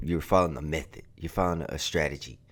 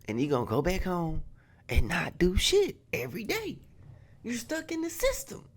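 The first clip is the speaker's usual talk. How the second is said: escalating comedy rant; 8 semitones higher than usual. Real, not acted. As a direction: build the rant into a high-pitched yell. S0 rant builds to high-pitched yelling.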